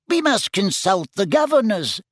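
high, small squeaky wizard; squeaky little voice. A narrator's reading. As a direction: pipe it up in a tiny squeak. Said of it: "in his squeaky little voice".

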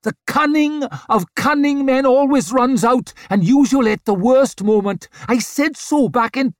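high, loud shouted tirade; shouting. A narrator's reading. Yort rails about cunning men until cut off, shouting. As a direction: keep shouting at the top of the voice. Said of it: "shouted Yort".

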